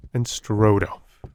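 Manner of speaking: plain reading voice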